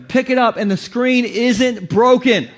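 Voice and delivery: normal talking voice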